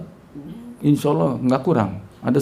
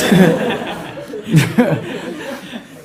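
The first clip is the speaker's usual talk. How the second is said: airy long laugh; unvoiced laughing; breathy laugh; 6 semitones higher, 3 dB louder than usual. Real, not acted. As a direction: laugh off the shock. Laughing after wow.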